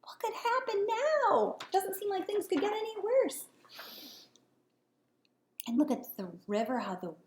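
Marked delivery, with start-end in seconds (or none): 0.0-3.5 high, panicky bullfrog; frightened. Acted. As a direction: fret as the water rises. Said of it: "he cried".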